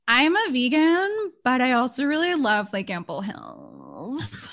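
put-on drawn-out valley girl drawl, lightly done; lazy hip Brooklyn twang; Brooklyn vegan voice. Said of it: "I was, like, a Brooklyn vegan where it's like".

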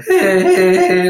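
high hey hits started with a glottal stroke. Shown as a demonstration. Coach demonstrating glottal strokes to the host, sharp hey hits. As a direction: punch each hey from the throat. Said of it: "glottal strokes".

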